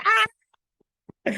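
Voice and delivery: very high excited squeal; a short high scream of joy; fangirl squeal. Retold or imitated. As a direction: shriek with delight. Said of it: "I was like".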